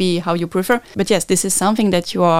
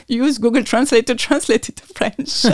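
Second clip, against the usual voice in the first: laughing at herself; 6 semitones higher than usual. Real, not acted. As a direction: confess it with a laugh. Laughs at own admission.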